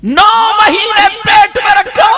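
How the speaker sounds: fiery pulpit shout; shouted preaching. Shown as a demonstration. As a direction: shout पेट to the crowd. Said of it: "repeated louder".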